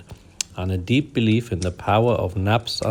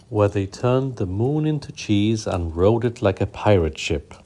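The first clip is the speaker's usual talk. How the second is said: usual speaking voice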